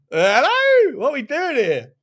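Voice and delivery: loud, high incredulous call; exasperated. Real, not acted. Streamer fed up with Tottenham's play, loud exasperated call. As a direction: demand an answer from the team.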